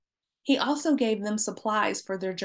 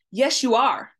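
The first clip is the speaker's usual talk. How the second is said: louder, mildly insistent retort; firm insistence; 3 semitones higher, 7 dB louder than usual. A narrator's reading. Joseph insisting to his brothers they are spies, mildly forceful. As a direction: push back firmly, with a touch of force. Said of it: "Joseph insisted".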